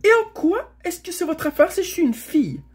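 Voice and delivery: put-on high, loud, indignant girl; angry bark. A narrator's reading. Stranger girl rebuking Shasta, barked indignantly. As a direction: bark it back, high and sharp. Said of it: "aboya l'étrangère".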